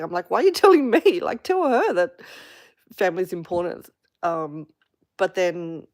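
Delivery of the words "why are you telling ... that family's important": higher and louder, laughing through the words; incredulous laughing retort. Retold or imitated. Retorting to the relative who lectures her on family, incredulous and laughing. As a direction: fire the advice back with a laugh.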